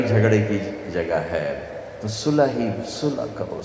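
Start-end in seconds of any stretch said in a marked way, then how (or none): 2.9-3.6 quiet plea. Acted. Urging reconciliation, voice dropping.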